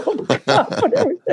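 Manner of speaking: high, laughing through the words; cracking up at the memory; words breaking into real laughter. Real, not acted.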